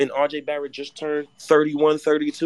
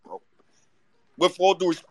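normal talking voice

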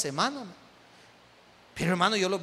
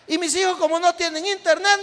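laughing while retelling; 8 semitones higher, 5 dB louder than usual. Retold or imitated. Pastor retells member's cheerful account.

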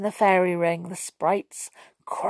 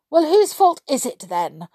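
higher, louder impatient question; 6 semitones higher, 5 dB louder than usual. A narrator's reading. Splash About questions the fairy about whose fault, impatient. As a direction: press for an answer impatiently. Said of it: "asked Splash About impatiently".

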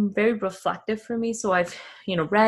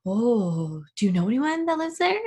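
mock curious asker. People learning she is Afghan, a light mock asking voice. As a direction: play the wide-eyed stranger, bright rising question. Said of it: "they look at you like".

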